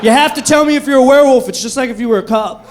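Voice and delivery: loud, high, laughing disbelief; incredulous yell. Retold or imitated. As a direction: shout it in mock outrage. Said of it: "It's like".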